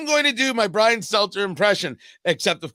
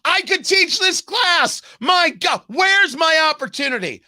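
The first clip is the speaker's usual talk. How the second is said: exasperated shout; 9 semitones higher, 5 dB louder than usual. Real, not acted. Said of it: "exasperated outburst".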